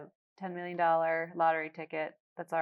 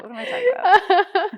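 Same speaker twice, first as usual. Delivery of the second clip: high and loud through real laughter; laughing; 12 semitones higher, 11 dB louder than usual. Real, not acted. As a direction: laugh at your own silly idea while asking. Laughing at her own boat idea.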